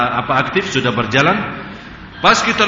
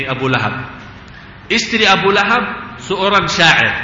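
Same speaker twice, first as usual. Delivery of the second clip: ordinary talk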